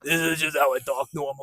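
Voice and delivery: mock raspy cool-guy voice, softened; imitation of an affected husky talker; put-on raspy voice. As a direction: rasp it as if it were natural. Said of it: "he's, like, making his voice raspy".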